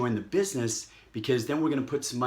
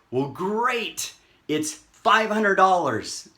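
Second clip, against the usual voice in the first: loud, high, stretched-out announcement; hammed-up cheerful seller; upbeat sales reveal; 7 semitones higher, 6 dB louder, slower than usual. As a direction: announce the price with mock gusto. Acted upbeat seller revealing the price.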